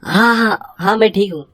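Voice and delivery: flustered. Acted. Flustered reply to neighbour.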